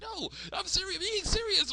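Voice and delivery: put-on very high, insistent girl voice; Candace. Daughter insists to a doubting mom, high protest. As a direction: protest in a squeaky falsetto. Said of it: "It's like".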